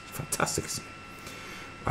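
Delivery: whispered mock delight; breathy sarcastic aside. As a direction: hiss it out with dry sarcasm.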